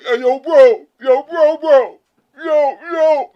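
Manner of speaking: loud, exaggerated fake man's voice; forced manly voice. Woman straining to sound male to her friends, mock manly voice. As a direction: put on a booming mock-macho call. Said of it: "make herself sound like a man".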